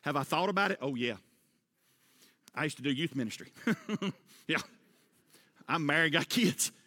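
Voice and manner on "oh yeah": normal talking voice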